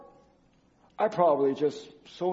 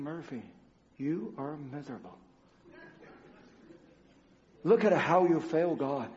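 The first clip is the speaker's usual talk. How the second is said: quiet inner voice; 6 semitones lower, 7 dB quieter than usual. Retold or imitated. Inner voice scolding himself.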